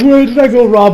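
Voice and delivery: a touch of mock crying; milder tearful plea. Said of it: "she's still tearing up".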